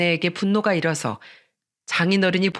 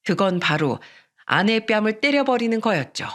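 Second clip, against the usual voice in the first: usual speaking voice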